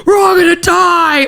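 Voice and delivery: high, drawn-out doom yell; mock panicked scream. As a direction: imitate a screaming student in a tornado. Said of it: "everybody starts screaming".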